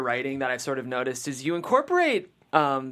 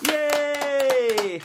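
cheer; 10 semitones higher than usual. Real, not acted. Cheering end of table read.

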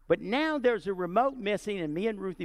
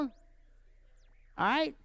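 ordinary talk

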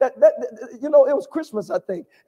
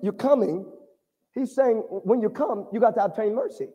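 usual speaking voice

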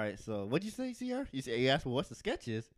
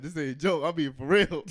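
raised, higher mock-earnest voice; mock insistence; 4 semitones higher, 9 dB louder than usual. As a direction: insist loudly, half in jest. Insisting through joking, voice raised.

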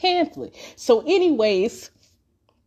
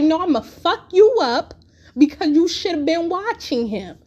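louder, higher, heated threat; threatening mom; 6 semitones higher, 4 dB louder than usual. Retold or imitated. Mother threatening to beat her kid, loud and higher. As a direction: bark it like she's about to swing.

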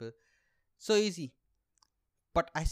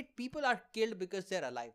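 usual speaking voice

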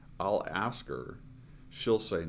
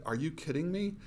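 higher, incredulous angry retort; retold angry outburst; 10 semitones higher than usual. Retelling his answer to the mission head, incredulous anger. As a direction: fire it back in disbelief. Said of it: "it just made me angry".

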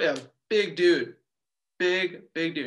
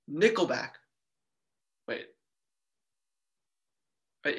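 ordinary delivery

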